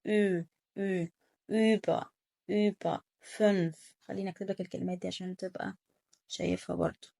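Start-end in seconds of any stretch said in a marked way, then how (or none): 0.1-3.8 long rounded ü, as in über and fünf; نطق ü. Shown as a demonstration. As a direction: say über and fünf slowly, modelling the ü. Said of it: "هو صوت الاو املاوت زي". (نطق ü بوضوح وبتمهّل في über وfünf)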